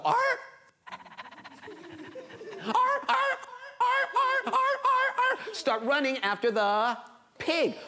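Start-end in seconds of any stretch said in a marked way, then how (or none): 0.0-5.5 dogs barking. Acted. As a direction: bark like excited hounds. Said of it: "the dogs go".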